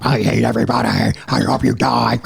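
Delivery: low, growled metal-singer monster voice; scary monster voice. Retold or imitated. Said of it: "the guy singing in the scary monster voice".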